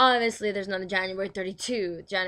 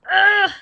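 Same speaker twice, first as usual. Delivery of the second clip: loud, high groan of longing; frustrated groan; 11 semitones higher, 15 dB louder than usual. Real, not acted. Wishing she knew about her dream, loud frustrated groan.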